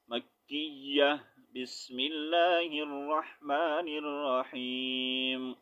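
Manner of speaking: slow melodic Quran recitation. Acted. Teacher reciting Surah Shams aloud to the class, slow and melodic. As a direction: recite it slowly, drawing out each verse.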